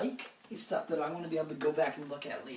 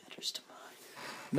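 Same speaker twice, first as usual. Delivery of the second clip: whisper; 10 dB quieter, slower than usual. Real, not acted. Whispered joke.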